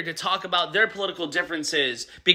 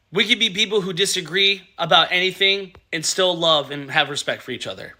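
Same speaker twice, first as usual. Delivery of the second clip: usual speaking voice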